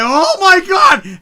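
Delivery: high, loud, drawn-out exclamation; losing his mind. Retold or imitated. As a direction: stretch it into an amazed shout. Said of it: "I'm sitting there losing my mind".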